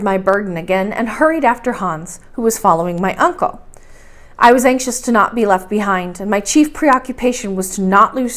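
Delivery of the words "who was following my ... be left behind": ordinary delivery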